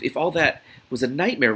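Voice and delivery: usual speaking voice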